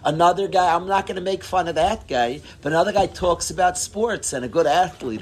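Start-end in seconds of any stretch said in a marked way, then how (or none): none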